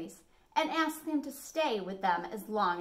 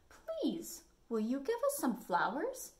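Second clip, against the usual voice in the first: little wolves asking; 5 semitones higher than usual. A narrator's reading. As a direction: ask sweetly like small wolves. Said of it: "asked the little wolves".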